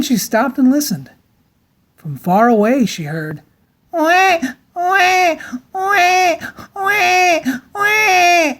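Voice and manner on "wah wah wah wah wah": put-on baby crying wah. A narrator's reading. Baby crying far away.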